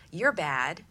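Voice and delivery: ordinary talk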